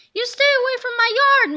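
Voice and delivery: high, loud furious yell at a passer-by; angry shout. A narrator's reading. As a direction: bark at her to keep off the lawn. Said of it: "Mister Krupp shouted".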